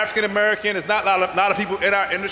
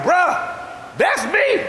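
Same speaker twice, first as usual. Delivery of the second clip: high, giddy bruh that's me; bursting to tell the janitor; excited; 9 semitones higher, much slower than usual. Retold or imitated. I'm so excited.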